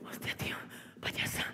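whispered aside, unvoiced; hushed shocked whisper. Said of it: "amigo Jesús susurra". (susurro asombrado del amigo)

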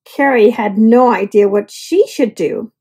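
ordinary delivery, nothing marked

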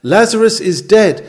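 ordinary delivery

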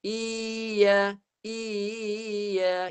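"iya" stretched by wobbling the voice, without a doubled y; wavering voice. Shown as a demonstration. Teacher shows the mistake, wavering voice in place of ya. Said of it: "Itu goyangkan suara".

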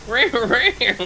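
playful cartoon jaguar roar; Diego's pet roar. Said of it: "That's what it sounds like".

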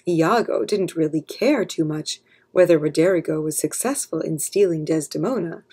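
ordinary delivery, nothing marked

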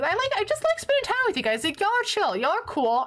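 high, quick, gushing fondness; excited. Real, not acted. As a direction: gush to viewers about enjoying their company. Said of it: "I get really excited about streaming".

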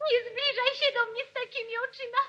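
high, fast, tearful fear; crying out in fright at her sister's eyes; frightened plea. Acted. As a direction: beg her to stay away, terrified. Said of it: "ja się ciebie boję".

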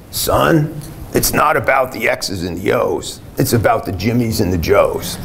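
put-on old Texas coach's Southern accent, only half-done. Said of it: "I can't do a good Southern accent".

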